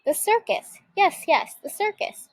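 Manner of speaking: gleeful witch, sure she's solved it; thrilled idea. A narrator's reading. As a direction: sell the brilliant idea to herself.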